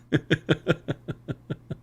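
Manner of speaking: long, light chuckling run; amused laughter after the squeal; real laugh. Real, not acted. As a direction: laugh softly in a quick stutter. Laughs during fan shout-out.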